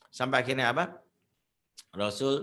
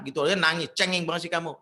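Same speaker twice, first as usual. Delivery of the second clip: loud, raised-pitch mock telling-off; scolding; 5 semitones higher, 6 dB louder than usual. Mother scolding her crying child, loud and dismissive. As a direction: belittle the crying child, imitating an annoyed mother. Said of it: "tiba-tiba datang ngomel".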